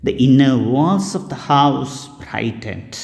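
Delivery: usual speaking voice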